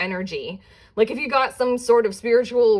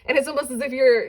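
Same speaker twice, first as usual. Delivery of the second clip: ordinary talk, nothing marked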